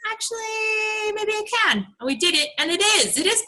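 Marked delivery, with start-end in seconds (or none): 0.1-1.8 higher, slow, dawning hope; light sing-song maybe I can; hopeful realization. Retold or imitated. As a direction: let the idea dawn on you slowly. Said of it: "we're like".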